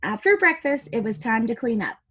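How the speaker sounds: usual speaking voice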